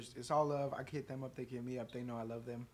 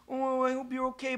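mock sympathy; 6 semitones higher, 4 dB quieter than usual.

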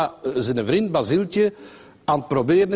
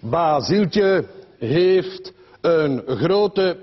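drawn out word by word, very slow; slow dictation pace; slow spelled-out writing; much slower than usual. Retold or imitated. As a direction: spell it out as if chalking big letters. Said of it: "schrijft in grote letters".

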